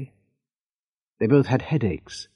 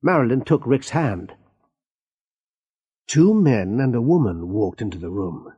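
ordinary delivery

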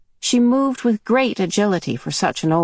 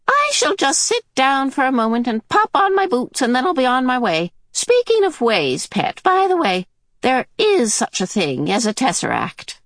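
put-on high, chatty old lady; old woman voice; 8 semitones higher than usual. Mrs Watson teasing Mrs Murray on her way, high and chatty. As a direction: drop the word casually.

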